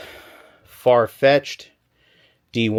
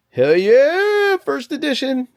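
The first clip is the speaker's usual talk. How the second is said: much higher and louder, a real cheer of joy; excited shout; 5 dB louder, slower than usual. Real, not acted. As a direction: yell it out as you find the prize card. Finds first edition cards.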